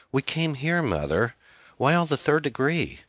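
put-on high, annoyed teenage girl; defensive daughter. A narrator's reading. Daughter questioned by mother on phone, defensive high voice. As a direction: push back at Mom with teenage irritation.

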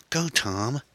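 high, urgent woman's plea; pleading, high-pitched urging. Acted. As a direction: urge him in a high voice. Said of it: "Erin pleaded".

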